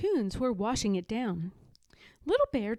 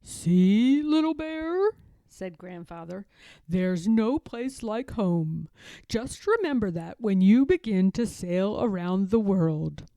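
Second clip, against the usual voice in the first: put-on a gentle old bear showing an old photo; Grandfather Bear; 6 dB louder than usual. Grandfather Bear showing his grandson a photo, fond old bear voice.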